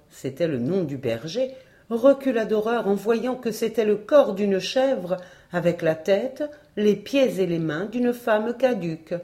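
usual speaking voice

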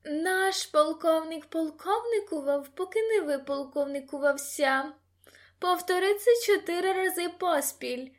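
high, giddy cry; shouted. A narrator's reading. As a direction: call out the tongue-twister as a tipsy dare. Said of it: "прокричала Сара".

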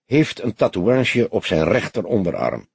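usual speaking voice

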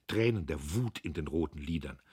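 ordinary delivery, nothing marked

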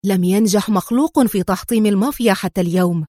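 ordinary delivery

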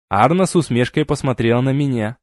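plain reading voice

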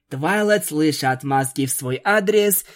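ordinary delivery, nothing marked

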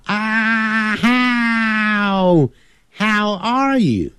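"how" stretched very long from a wide ah, high; exaggerated open "how". Shown as a demonstration. Teacher drilling learners on opening the mouth, hugely drawn-out "how". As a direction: hold a huge ah into "how". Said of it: "先念啊的音啊".

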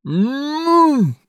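high, strained hum through sealed lips; muffled grumble. A narrator's reading. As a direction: grumble with mouth sewn shut. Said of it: "пробурчал Тед".

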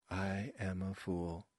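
stiff, soft, slow recital on one note; monotone line reading. A narrator's reading. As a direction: recite it softly and slowly, like one key struck repeatedly. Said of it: "like someone hitting the same note on a keyboard".

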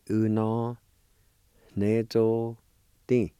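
Uno, nezo, ti said as three separate chunks; in three parts. Shown as a demonstration. Teacher showing a word broken into parts. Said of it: "Break it down in three parts".

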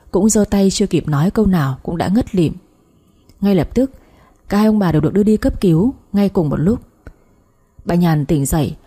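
ordinary delivery

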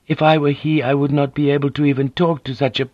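a poised noblewoman smoothing things over; polite Japanese lady. A narrator's reading. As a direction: voice Mariko as a courteous, composed wife. Narrator voices Mariko.

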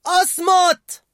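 very high, loud scream; furious shout. A narrator's reading. Riley screaming shut up at her father. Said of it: "فصاحت رايلي".

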